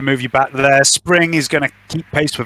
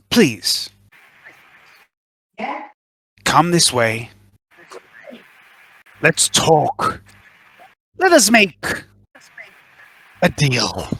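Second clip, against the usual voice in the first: slow, measured villain voice; a cool, patient threat; calm menacing villain; slower than usual. Acted. The same voice invites them.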